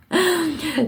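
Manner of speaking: short high laugh. Real, not acted. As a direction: burst out laughing. Laughs at own joke.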